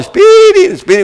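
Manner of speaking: high, loud call; calling the dog. Retold or imitated. Calling the lost dog across the roads, loud high call. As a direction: call out after the runaway dog. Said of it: "roads and calling".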